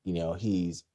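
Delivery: ordinary delivery, nothing marked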